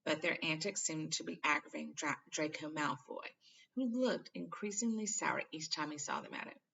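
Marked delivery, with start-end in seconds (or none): none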